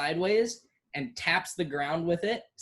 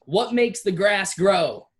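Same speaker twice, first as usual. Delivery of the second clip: higher, louder chant to the troops; battle cry; 4 semitones higher, 6 dB louder than usual. Acted. General calls out to his soldiers, in a loud battle chant. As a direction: bark the question at the ranks. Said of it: "he says the words".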